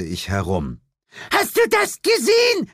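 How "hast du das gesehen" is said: put-on very high, shrill old lady's cry; very high old woman cry; shrieking old lady. A narrator's reading. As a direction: screech in horror. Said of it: "schrie eine der alten Damen".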